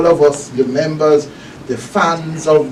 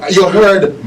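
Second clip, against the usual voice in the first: ordinary talk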